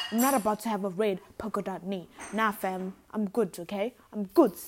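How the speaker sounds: loud, sassy no thanks; sassy refusal. Retold or imitated. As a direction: wave it off with attitude.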